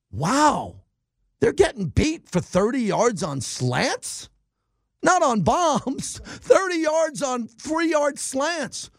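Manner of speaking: high, slow, incredulous Wow; stunned disbelief. Retold or imitated. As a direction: drag out the disbelief over the slants, laughing at it. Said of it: "And I said".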